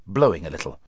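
ordinary delivery, nothing marked

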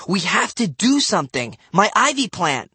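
high, urgent shout. A narrator's reading. Matt shouts over two squabbling kids, urgent and high. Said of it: "had to shout at the top".

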